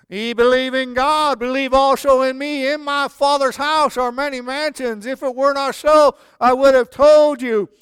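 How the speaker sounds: loud, high, rising preacher recitation; preaching scripture. Acted. Pastor reciting John to the church, full preaching voice. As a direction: proclaim it to the congregation.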